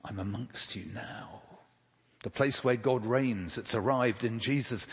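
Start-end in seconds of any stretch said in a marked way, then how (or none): none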